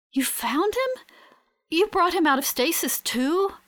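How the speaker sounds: high, rising, wonderstruck question; wonder. A narrator's reading. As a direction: ask in amazed disbelief at good news. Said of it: "in a tone of wonder".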